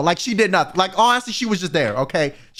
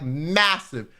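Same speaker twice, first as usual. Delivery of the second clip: ordinary talk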